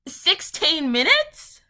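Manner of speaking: loud, high and drawn-out disbelief; incredulous outburst. Real, not acted. Ranting to viewers about listening, incredulous. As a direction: stretch it out, high and indignant.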